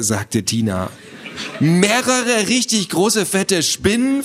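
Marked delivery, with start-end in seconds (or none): 1.6-4.2 high, slow horrified echo; shocked question. Acted. Boy hears there may be several spiders, shocked. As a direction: stretch it out in rising shock. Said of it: "fragte Theo angemessen schockiert".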